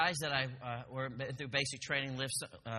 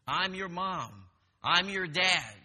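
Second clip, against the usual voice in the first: loud, raised drill-instructor declaration; yelling drill instructor; 4 semitones higher, 6 dB louder, slower than usual. Retold or imitated. Drill instructor lays down rules to recruits, yelling. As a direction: lay down the law, punching each title. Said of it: "drill instructor's still yelling at you".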